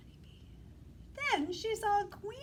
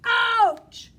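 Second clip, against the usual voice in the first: loud yelp; 8 semitones higher, 18 dB louder than usual. Shown as a demonstration. Teacher as the stung bear, yelling loudly. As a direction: yell ouch loud and higher. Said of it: "Try it one more time".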